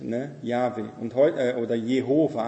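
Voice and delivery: ordinary talk, nothing marked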